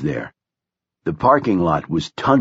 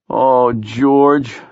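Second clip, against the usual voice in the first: long, raised moan on the name; grieving moan; 3 semitones higher, 4 dB louder than usual. A narrator's reading. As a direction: moan it out in grief. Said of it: "Blaze moaned".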